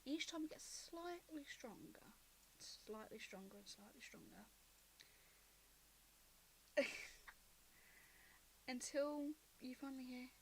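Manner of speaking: ordinary talk, nothing marked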